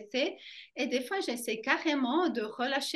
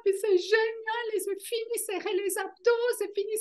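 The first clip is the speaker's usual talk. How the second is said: much higher, excited quoted gushing; gushing friend; 10 semitones higher, 3 dB louder than usual. Retold or imitated. Friend raving about fascia, high and excited. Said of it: "elle était complètement".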